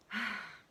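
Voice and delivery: loud exhaled sigh; exasperated sigh in a role-play; heavy sigh. Shown as a demonstration. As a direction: let out a sigh before confronting someone. Acted example to late Mark.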